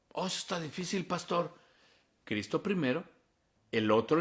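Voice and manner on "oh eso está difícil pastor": soft, higher-pitched objection; small, high voice of a reluctant churchgoer; reluctant congregant. Acted. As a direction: mimic a meek complaint to the pastor.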